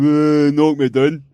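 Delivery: slow, drawn-out mock taunt; leery taunt. Leery lads goading a driver to hit them, slow taunt. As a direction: goad the driver lazily. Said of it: "with the arms out going".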